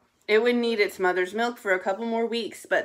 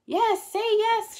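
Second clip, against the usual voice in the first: very high, drawn-out cub cheer; shouting cubs; 13 semitones higher, slower than usual. A narrator's reading. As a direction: urge her in a high, excited child voice. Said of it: "shouted the cubs".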